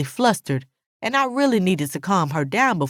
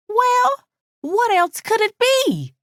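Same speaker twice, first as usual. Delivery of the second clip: much higher-pitched, insistent questioning; high, slow demanding voice; Alice pressing; slower than usual. A narrator's reading. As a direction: press her for an answer, high and drawn out. Alice presses narrator for answer.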